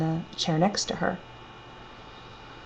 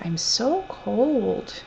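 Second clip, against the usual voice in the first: lightly voiced frail old woman, slow and a touch higher; a hint of a shivering old lady, drawn out slowly; 5 semitones higher, much slower than usual. Old roommate telling the narrator she's cold, lightly voiced frail and slow.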